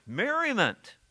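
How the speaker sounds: usual speaking voice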